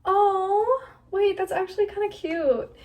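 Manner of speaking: high, delighted surprise; pleasant surprise. Real, not acted. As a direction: sound suddenly pleased. Surprised by good result.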